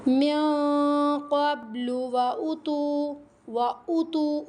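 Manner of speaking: slow melodic chanted recitation; Quran recitation. Acted. Teacher reciting the verse to her students, melodic chant. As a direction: chant the verse in measured tajweed.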